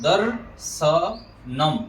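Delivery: दर्शनम very slow, "darr... sha... nam"; syllable demo. Shown as a demonstration. Teacher shows how दर्शनम is built, slow demo. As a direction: roll the र and space out दर्शनम. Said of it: "ऐसे करके होगा".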